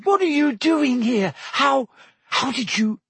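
very high, astonished questioning; astonished squeal. A narrator's reading. Hermione questions Harry's sudden arrival, astonished squeal. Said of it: "squealed Hermione".

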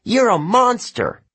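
high, loud furious cry; yelling. A narrator's reading. Annabeth yelling at Luke, loud and high. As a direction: scream the insult at him. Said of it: "she yelled".

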